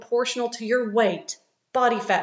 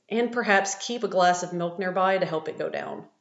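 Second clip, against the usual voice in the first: ordinary talk, nothing marked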